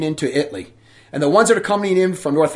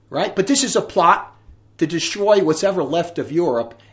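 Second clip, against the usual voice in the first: ordinary talk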